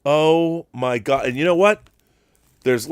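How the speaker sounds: ordinary delivery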